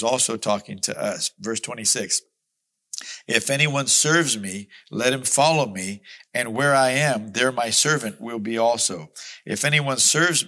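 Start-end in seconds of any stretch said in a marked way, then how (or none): 3.3-10.5 loud, ringing scripture proclamation; booming pulpit reading; preaching at full force. Acted. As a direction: proclaim the verse to the whole church.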